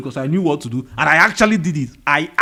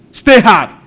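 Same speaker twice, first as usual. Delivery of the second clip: punchy, louder and higher battle cry; loud motto; 4 semitones higher, 8 dB louder than usual. Retold or imitated. Quoting David Goggins' rule to viewers, loud punchy shout. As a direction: bark it out like a drill sergeant's motto.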